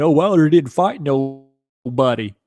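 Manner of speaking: slow, drawn-out mock British accent of a Fury fan; sneering Fury fan in a put-on British accent. Streamer mocking Fury fans in a slow British accent. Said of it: "my poor attempt of a British accent".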